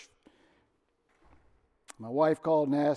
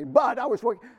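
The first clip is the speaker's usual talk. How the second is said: loud, higher indignant protest; defensive retort; 8 semitones higher, 9 dB louder than usual. Retold or imitated. Husband nearly protesting to his wife, loud and indignant. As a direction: snap back in self-defence. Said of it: "I was gonna say".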